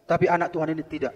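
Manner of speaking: ordinary talk, nothing marked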